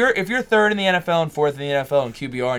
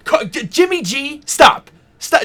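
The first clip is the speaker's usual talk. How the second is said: incredulous protest; 13 semitones higher, 8 dB louder, slower than usual. Real, not acted. Friend lists Jimmy Garoppolo as better.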